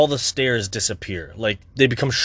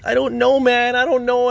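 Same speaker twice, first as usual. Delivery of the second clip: high, louder, frantic; agitated; 11 semitones higher, 4 dB louder than usual. Real, not acted. Vlogger venting, high agitated blurting. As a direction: blurt it out, overwhelmed. Anxious rant about ADHD.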